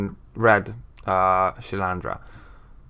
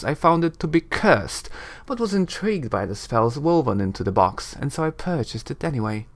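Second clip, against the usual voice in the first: higher, lighter lady voice; musing sorceress; 5 semitones higher than usual. Acted. Shilandra recalls buying the box, musing airily. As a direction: think back aloud in an airy character voice.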